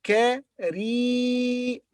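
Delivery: kareem, slow, with a long ee; kareem, drawn-out ee sound. Shown as a demonstration. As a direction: stretch the ee in kareem. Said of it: "followed by long vowel yi".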